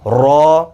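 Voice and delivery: را with rounded lips, full and heavy; a thick rounded را; heavy letter demo. Shown as a demonstration. Teacher models a heavy را. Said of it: "ایک الف کے برابر کھینچنا ہے".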